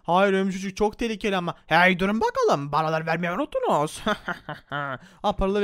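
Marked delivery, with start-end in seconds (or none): 1.7-4.0 a lightly put-on gruff guard stopping them; lightly voiced guard demanding coins; gatekeeper voice. Gatekeeper stops players for money.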